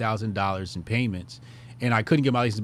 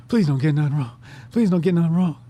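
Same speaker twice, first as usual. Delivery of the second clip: rising, louder frantic plea; desperate pleading; 6 semitones higher, 4 dB louder than usual. Retold or imitated. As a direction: beg the computer, getting louder each time. Praying nervously during the test.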